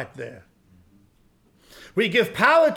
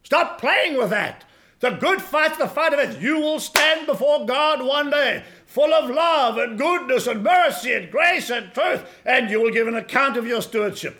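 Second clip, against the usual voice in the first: high, raised, emphatic preacher; forceful preaching; 6 semitones higher, 6 dB louder than usual. Acted. Preacher warns of standing before God, forceful preaching. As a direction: proclaim each phrase with mounting weight.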